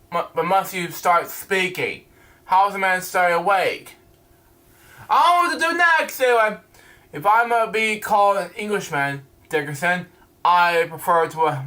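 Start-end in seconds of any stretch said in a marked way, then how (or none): 5.1-6.6 higher and fast, rushing; a quick, high flustered mumble; flustered aside. Real, not acted. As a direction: blurt it out while losing your place. Reader lost in the script.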